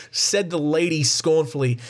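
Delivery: ordinary delivery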